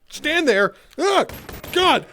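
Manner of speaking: loud, high startled yelp; jolted mid-sentence, voice jumping up; startled outburst. Real, not acted. Streamer interrupted while explaining coworkers, startled.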